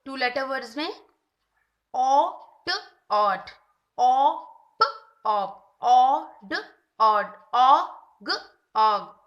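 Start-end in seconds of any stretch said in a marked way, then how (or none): 1.9-9.2 slow, loud sound-by-sound blending; teacher blending two-letter words for a child; phonics blending. Shown as a demonstration. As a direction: say each sound, then join them. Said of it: "ओ की blending".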